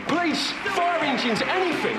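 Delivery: high, loud frantic yelling for help; mock panic shout; panicked shouting. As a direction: yell for every emergency service at once.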